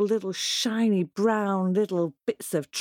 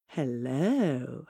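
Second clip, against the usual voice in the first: sly fox; 6 semitones lower, 3 dB quieter than usual. Acted. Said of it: "said Foxy Loxy".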